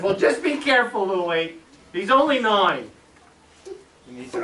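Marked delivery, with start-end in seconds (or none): 0.0-2.9 high, loud imitation of mother; cautioning mother. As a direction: warn him in a high, motherly voice. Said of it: "And my mother said".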